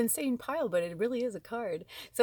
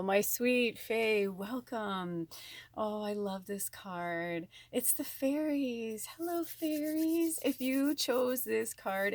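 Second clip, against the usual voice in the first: tender greeting; 3 semitones higher, much slower than usual. Acted.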